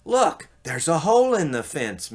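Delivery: lightly voiced excited Mickey; excited pointing out. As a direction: call attention eagerly.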